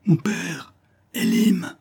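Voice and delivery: very high, straining call; last desperate cry. A narrator's reading. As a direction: gather all strength for one final call. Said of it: "toutes ses forces pour crier une dernière fois".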